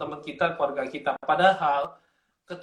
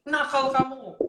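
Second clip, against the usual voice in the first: high-pitched mock scolding; pura-pura teriak marah; 8 semitones higher than usual. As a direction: act out a father's staged telling-off. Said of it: "dia itu teriak-teriak gitu". (meniru ayah berteriak memarahi anak)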